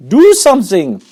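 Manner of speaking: high, loud demand; a wife screaming at her husband to help. Acted. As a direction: shriek the order. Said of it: "Missus Gloop screamed".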